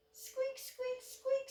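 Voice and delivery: tiny high squeaks; mouse squeak. A narrator's reading. Little mouse answers the owl with squeaks. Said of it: "said the mouse".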